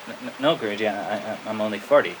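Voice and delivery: soft, stammering denial; meek. Retold or imitated. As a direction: stammer it timidly to the teacher. Clueless resident answering the teacher timidly.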